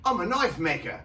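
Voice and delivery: put-on Australian accent. Australian guy naming his trade, Australian accent. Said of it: "I meet this Australian guy".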